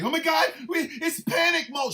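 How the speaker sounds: high, loud mock panic; mocking panicked Democrats. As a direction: squeal like a panicking coward. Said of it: "act like".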